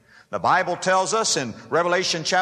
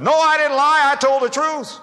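loud indignant protest, pitched up; protesting denial; 10 semitones higher, 7 dB louder than usual. Retold or imitated. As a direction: insist hotly that you told the truth. Retorting to the Holy Spirit.

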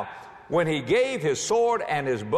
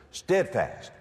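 ordinary talk, nothing marked

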